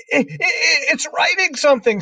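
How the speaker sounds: high, loud, stuttering alarm; stammer, then shriek it out in amazement; screamed stammer. A narrator's reading. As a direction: hysterical schoolboy scream. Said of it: "screamed Nigel".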